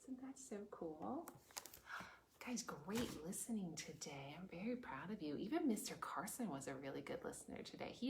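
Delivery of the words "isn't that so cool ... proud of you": soft hushed praise; whisper. Real, not acted. Teacher whispering praise to the listening kids. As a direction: quietly praise them.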